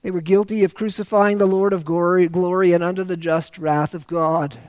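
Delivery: ordinary talk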